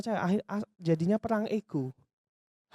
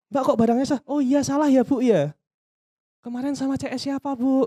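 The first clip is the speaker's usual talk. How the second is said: mock complaining customer; 5 semitones higher, 5 dB louder than usual. Customer complaint role-play.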